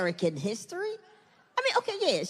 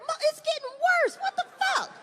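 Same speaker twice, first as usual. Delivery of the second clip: much higher exasperated cry. Retold or imitated. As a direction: protest in disbelief. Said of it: "I'm like".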